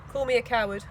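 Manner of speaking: plain reading voice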